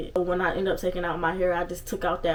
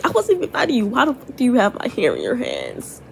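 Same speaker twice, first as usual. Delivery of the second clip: outraged; 4 semitones higher, 5 dB louder than usual. Retold or imitated. Angry at girl with her hair.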